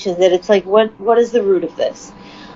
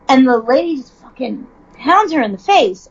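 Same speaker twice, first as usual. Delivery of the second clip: usual speaking voice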